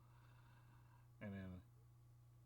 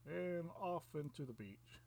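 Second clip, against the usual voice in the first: much higher, slow, laughing through it; 9 semitones higher, slower than usual. Retold or imitated. Storyteller laughs while voicing the creature leaving. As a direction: crack up while saying "bloop".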